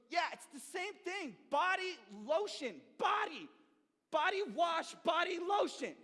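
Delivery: high, loud, exasperated insisting; insistent shout. Retold or imitated. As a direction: yell it like it's obvious. Brother insisting loudly.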